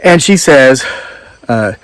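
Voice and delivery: normal talking voice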